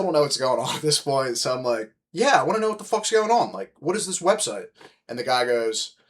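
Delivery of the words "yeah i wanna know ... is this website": retold indignant demand.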